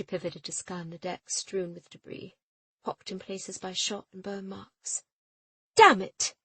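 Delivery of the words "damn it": loud, high, falling damn it; a furious shouted damn it; shouted curse. A narrator's reading. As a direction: belt the curse out. Captain curses at the damage.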